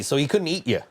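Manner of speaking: usual speaking voice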